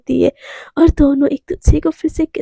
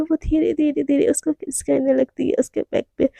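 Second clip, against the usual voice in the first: hushed narration; 7 dB quieter than usual. A narrator's reading. Narrating intimate scene softly.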